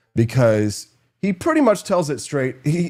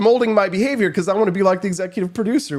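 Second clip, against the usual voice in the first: freaking out; 9 semitones higher, 3 dB louder than usual. Retold or imitated. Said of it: "But I start freaking out".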